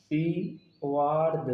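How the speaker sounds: "आशीर्वाद" very slowly, broken into syllables; slow syllabic reading. Shown as a demonstration. Teacher showing how this spelling would read, "आशीर्वाद" drawn out in parts. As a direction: sound out "आशीर्वाद" piece by piece. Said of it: "जब इसको हम पढ़ेंगे तो क्या पढ़ेंगे?".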